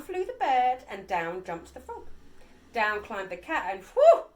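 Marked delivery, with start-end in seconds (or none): 3.8-4.2 high loud whew; relieved whew. A narrator's reading. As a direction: let out huge relief. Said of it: "said the dog".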